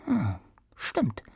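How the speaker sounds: slow, thoughtful whisper; musing whisper. Acted. Queen musing to herself.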